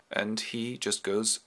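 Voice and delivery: usual speaking voice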